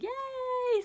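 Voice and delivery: high, loud celebratory cheer; cheering with child. Acted. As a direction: cheer big for a little one. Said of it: "we always clap at the end".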